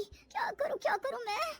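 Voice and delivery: soft, high, anxious voice; high worried dithering; panicked fretting. Acted. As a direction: fret helplessly to yourself. Panicking over frozen mother-in-law.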